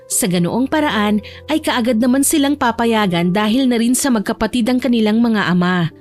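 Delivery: ordinary delivery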